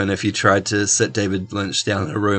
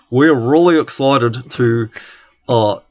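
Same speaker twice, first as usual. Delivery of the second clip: put-on thick New Zealand accent; 2 semitones higher, 3 dB louder than usual. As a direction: read it like stiff cue cards in a broad kiwi accent. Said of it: "the thickest New Zealand accent".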